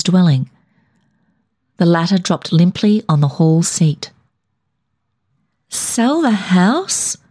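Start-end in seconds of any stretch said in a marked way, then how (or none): 5.7-7.2 slow, stunned repeat; drawn-out disbelief, lightly voiced; flatly. A narrator's reading. Alberta hears the house will be sold, stunned and slow. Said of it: "she repeated flatly".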